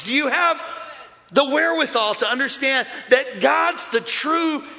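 high-pitched preacher building to a shout; impassioned pulpit shouting, rising in pitch; fired-up preaching. Real, not acted. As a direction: preach it hot, building to full force. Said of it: "I'm coming at you hot today".

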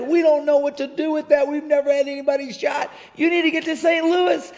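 high, loud alarmed voice. Retold or imitated. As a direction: sound alarmed and overwhelmed. Hospital staff urgently refusing.

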